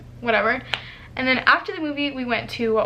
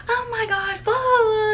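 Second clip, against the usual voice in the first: high-pitched excited gushing; mock-giddy babble; retold freaking out; 10 semitones higher than usual. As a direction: imitate a friend squealing with excitement. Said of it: "we're freaking out".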